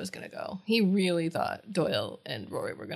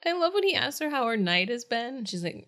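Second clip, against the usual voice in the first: ordinary talk, nothing marked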